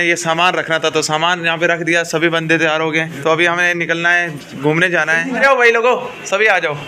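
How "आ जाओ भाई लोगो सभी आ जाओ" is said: raised, higher call to the group. Real, not acted. Vlogger to his friends before heading out, calling out. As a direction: call the gang over, louder and higher.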